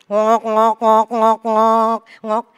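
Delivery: imitated grinding machine sound; coffee machine noise. Wife imitating the coffee machine each morning, mechanical. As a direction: sputter it like an espresso machine.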